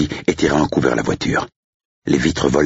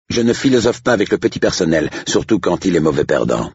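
higher-pitched, dismissive sneer; haughty estate agent; 6 semitones higher than usual. Acted. Agent refuses to philosophise with staff, haughty. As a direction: talk down to the help.

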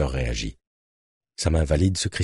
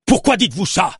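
hors de lui; 13 semitones higher, 3 dB louder than usual. A narrator's reading. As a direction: question criée, bien plus haute. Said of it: "Galiléo paraissait hors de lui". (furieux, hors de lui)

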